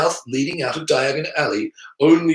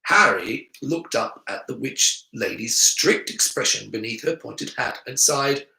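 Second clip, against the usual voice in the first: usual speaking voice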